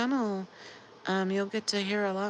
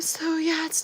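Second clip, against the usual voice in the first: yawning; 9 semitones higher, 9 dB louder than usual. Real, not acted. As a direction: still yawning, higher and louder. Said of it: "I'm yawning".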